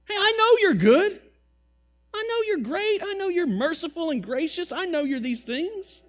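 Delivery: a petulant prophet telling God off; whiny Jonah. Retold or imitated. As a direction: Jonah whining at God, high and nagging. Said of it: "For it is better to me to die".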